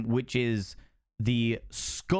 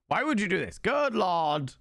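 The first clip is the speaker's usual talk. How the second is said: mock dismay; 7 semitones higher than usual. Mock reproach to viewer Dabba.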